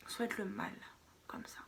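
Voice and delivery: ordinary talk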